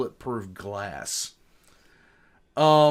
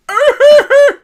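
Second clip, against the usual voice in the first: high loud cackle; loud burst of laughter; 18 dB louder than usual. Real, not acted. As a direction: explode into a laugh. Laughing at muted teammate.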